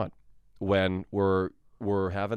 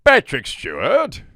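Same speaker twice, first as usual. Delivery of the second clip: slow, loud, higher grand delivery of the name; Patrick Stewart; 6 semitones higher, 8 dB louder, slower than usual. Retold or imitated. Answering an audience question as Patrick Stewart, slow grand voice. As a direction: boom the name out slowly, like a grand stage actor. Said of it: "how to do a Patrick Stewart impression".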